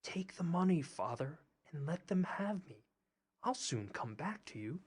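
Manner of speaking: soft and hushed, close to the ear; whispered. A narrator's reading. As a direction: breathe it into his ear. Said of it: "whispered in his ear".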